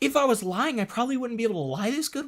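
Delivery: high, loud, fast mocking parrot of his boast; mocking imitation. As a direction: mimic the boast in a squeaky mocking voice. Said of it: "mocking the streamer's words".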